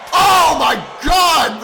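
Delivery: slow, loud, high "oh my God"; excited shout. Real, not acted. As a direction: stretch out the shout in awe. Slaps land live.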